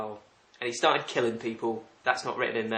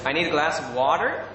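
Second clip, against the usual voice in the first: American accent with hard R in "water"; 2 semitones higher, 3 dB louder than usual. Shown as a demonstration. Brit trying requested phrases, attempted American accent. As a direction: put on an American "wah-der". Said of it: "my best American accent".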